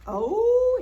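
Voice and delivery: high loud oh; surprised. A narrator's reading. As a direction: gasp out a surprised oh. Surprised exclamation in story.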